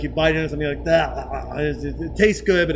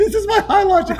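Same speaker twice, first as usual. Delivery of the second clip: high squeal through laughter; real laughter pushing the voice up high; high laughing; 6 dB louder than usual. Real, not acted.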